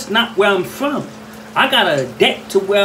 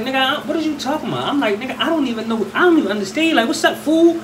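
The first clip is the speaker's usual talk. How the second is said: confused pushback; 6 semitones higher, faster than usual. Retold or imitated.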